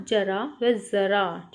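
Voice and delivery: जरा said slowly twice, first with ज then with buzzing ज़; slow model of जरा versus ज़रा; nukta contrast. Shown as a demonstration. Teacher demonstrating nukta difference for children, slow and clear. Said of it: "बोलकर पढ़िए व पहचानिए कि दोनों में क्या अंतर है".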